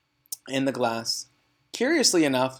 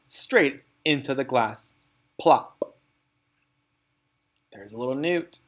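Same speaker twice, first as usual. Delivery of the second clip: ordinary delivery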